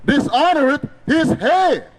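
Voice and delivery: ordinary delivery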